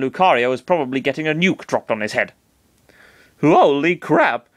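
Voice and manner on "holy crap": slow, higher stunned exclamation. Acted. Chris reacts to Master Hand's dire predictions, stunned and slow. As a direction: draw it out in shock. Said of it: "Chris said".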